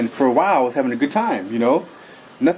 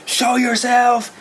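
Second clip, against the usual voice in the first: retold scream; 10 semitones higher, much slower than usual. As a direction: relive the scream, stretched out high. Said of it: "I was screaming".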